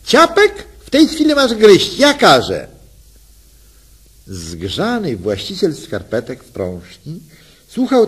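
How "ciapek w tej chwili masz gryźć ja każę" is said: high, loud comic command; mock-serious shout. Wife commands husband with comic sternness, loud and high. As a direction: order him like a pretend general. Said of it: "z komiczną powagą krzyknęła".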